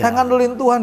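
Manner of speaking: high-pitched indignant scolding, acted out; retold anger. Husband scolds wife for losing faith, re-enacted angry. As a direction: re-enact telling her off, pitch held up throughout. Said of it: "saya marah".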